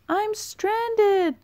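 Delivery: very high, loud desperate shout; shouting for help. A narrator's reading. Stranded bullfrog shouting for help in the flood, very high and loud. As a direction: scream for rescue.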